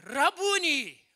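loud, high, drawn-out exclamation; acted joyful outcry; Mary's recognition cry. Mary cries out on recognising Jesus.

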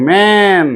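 ম্যান with a long open "ae"; jophola-akar vowel. Shown as a demonstration. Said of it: "অ এ জফলা আকার".

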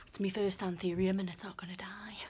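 quick, hushed whisper; fast proud whisper. Real, not acted. Proud of anthurium print.

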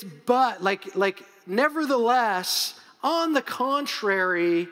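"nevertheless, on the contrary" slow and pressed; emphatic contrast. Shown as a demonstration. Pastor explaining Greek word, slow emphatic contrast. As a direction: lean hard on "nevertheless", drawn out. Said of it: "it is emphatic".

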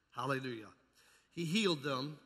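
usual speaking voice

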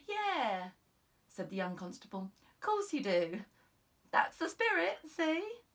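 upbeat junior officer cheering her on; young constable, encouraging. Acted. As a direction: buck up your boss. Constable boosting Cupidi.

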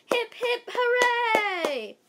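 high, loud group cheer; yelled cheer. Acted. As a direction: belt it out like a whole crowd. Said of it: "everybody clapped ... and yelled".

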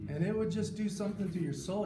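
ordinary delivery

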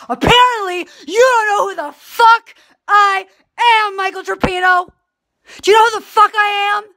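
high, loud, stretched-out diva yell; milk every word, shouting in affronted disbelief; hammed-up outrage. Saying what she'd never say to Michael, mock outrage. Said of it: "because I love saying this".

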